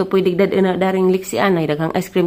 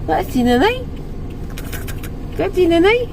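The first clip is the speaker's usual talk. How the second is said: pitched way up, rising questions; 8 semitones higher than usual. Real, not acted. Asking baby where mom is. (taas nga tingog nga nangutana sa bata)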